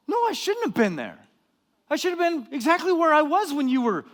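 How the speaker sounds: high, loud indignant comeback; defensive rant. Retold or imitated. Preacher snapping back at a friend bragging about skiing, indignant. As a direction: fire back, bristling and offended. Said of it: "I get really defensive".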